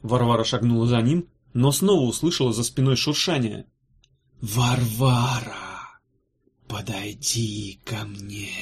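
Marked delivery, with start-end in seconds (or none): 4.4-6.0 very slow, eerie drawn-out name; ghostly voice. Acted. Ghost voice calls her name. 6.7-8.5 very slow, eerie drawn-out name; ghostly voice. Acted. Ghost voice beckons.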